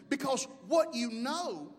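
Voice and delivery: ordinary delivery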